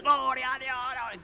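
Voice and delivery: high, drawn-out mock yell, held back in volume; grito imitado. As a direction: mimic someone yelling wildly in prayer. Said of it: "comienzas a gritar". (grito fingido de loco, agudo y alargado)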